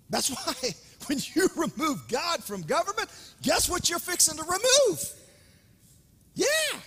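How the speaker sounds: high, loud preaching climax; rousing shout. Real, not acted. Preacher warning congregation about removing God, rising to a shout. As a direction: build to a full cry on the last word.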